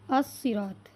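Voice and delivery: "अस्सिरात" with a doubled 's' and no 'l'; lam silent. Shown as a demonstration. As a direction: run 'a' straight into a held 's' in "अस्सिरात". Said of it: "इसकी आवाज़ भी लाम की नहीं आ रही है". ("अस्सिरात" में लाम चुप, स्वाद दोहरा)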